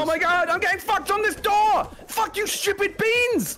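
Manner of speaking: furious shout. Real, not acted. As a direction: scream at the obstacles. Blocked by players in game.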